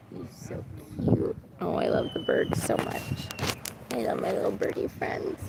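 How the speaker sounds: soft breathy whisper of affection; whispered cooing. Real, not acted. Fawning over her pet birds, whispered. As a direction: whisper sweet nothings to a tiny bird.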